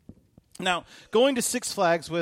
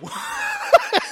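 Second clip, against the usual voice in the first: a big burst of laughter; loud high laugh; 6 dB louder than usual. Real, not acted. Pastor laughing at his own story, loud and high.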